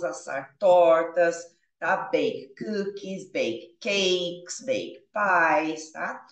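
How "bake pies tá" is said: ordinary talk, nothing marked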